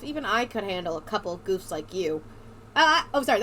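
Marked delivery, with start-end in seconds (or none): 0.0-2.2 put-on lightly Southern accent, lower and measured; a hint of a Southern US twang. Girl taunts two goons, in a slight Southern accent. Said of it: "that was a southern accent".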